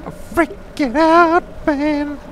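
high, loud, stretched freak-out; mock panic. Player admitting they're freaking out, shrill mock panic. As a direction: squeal it in hammed-up panic.

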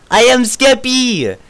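loud, slow proud intro; Skeppy impression. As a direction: belt it out like a hyped streamer. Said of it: "It's Skeppy".

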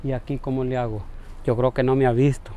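dropping to a low worried murmur; low worried murmur on spotting the man he owes money; worried low aside. Acted. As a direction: hide your panic, mutter to yourself.

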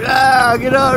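high-pitched reenacted shooing shout; mock startled yell. Retelling shooing animal from trash.

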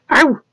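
high, short yelp of pain; sudden high-pitched "ow". A narrator's reading. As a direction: yelp as if pinched. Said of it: "he yelped when Laura pinched his forearm".

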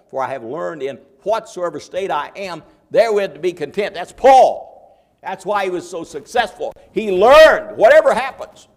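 building into a loud, high preaching cry; ringing preacher's climax; preaching shout. Real, not acted. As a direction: lift it to full force for the church.

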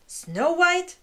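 high, loud shocked screech. A narrator's reading. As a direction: shriek the name in disbelief. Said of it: "screeched the queen".

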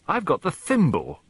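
put-on high, plaintive girlish complaint; dismayed child's wail. A narrator's reading. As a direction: wail it in dismay, falling at the end. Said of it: "wailed Bridget".

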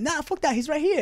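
high-pitched excited friend; acted insistence. Friend pointing out the rapper nearby, acted excited insistence.

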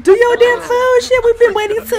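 real frustrated yell. Real, not acted. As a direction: bark the order at the lazy sim. Yelling at sim to cook.

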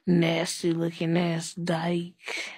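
ordinary talk

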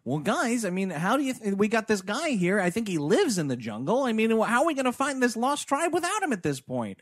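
lightly voiced movie hero; mock character voice. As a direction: fret aloud like a movie leader.

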